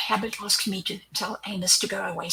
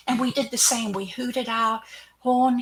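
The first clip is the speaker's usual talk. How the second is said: ordinary talk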